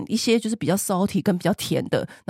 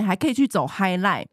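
ordinary delivery

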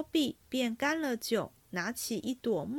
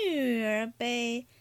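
slow, drawn-out verse opening; stuck for words; slower than usual. A narrator's reading. Flustered oaf gropes for his verse, slow stalling recital. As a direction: stretch each syllable while stalling.

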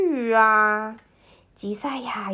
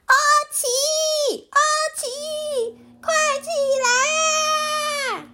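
shouting; faster than usual. Acted. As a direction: yell to wake him up. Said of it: "大叫着".

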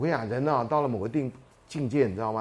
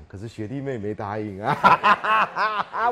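sly laughing aside; much slower than usual. Retold or imitated. Inner thought, sly joke.

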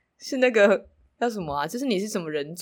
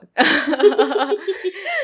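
loud, high burst of laughter; 11 semitones higher, 10 dB louder than usual. Real, not acted. Laughs at own joke.

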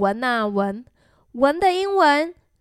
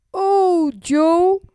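higher, drawn-out bear cry; howling; 4 semitones higher, 3 dB louder than usual. A narrator's reading. Bear to Joe after the laughter, howling. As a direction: howl it out, moderately. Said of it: "the bear howled".

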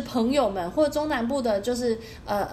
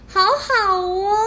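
gushing aww; 8 semitones higher, 6 dB louder, much slower than usual. Real, not acted. Touched by a kind stranger story.

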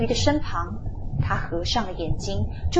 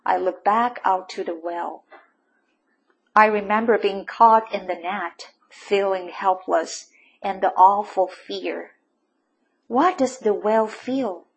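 plain reading voice

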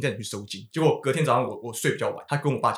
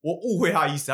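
usual speaking voice

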